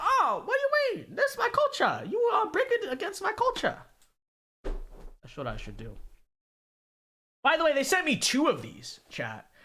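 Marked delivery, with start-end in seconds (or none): 0.0-3.8 very high, put-on caricature voice; mock indignation. Student retorting to imagined liberal professor, mock-indignant caricature. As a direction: squeal in hammed-up offense.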